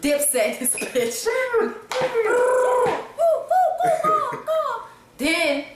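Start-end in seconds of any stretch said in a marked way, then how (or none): 1.3-4.9 high shrieking laugh. Real, not acted. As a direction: let out a high cackle.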